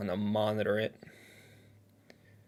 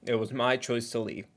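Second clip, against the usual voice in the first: ordinary delivery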